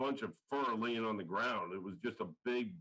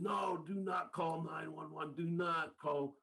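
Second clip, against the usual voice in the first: higher, slow pleading as the stranger; imitating the odd stranger, slow and higher; 5 semitones higher, slower than usual. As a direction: beg slowly, a notch up, not to call. Stranger refuses the 911 call.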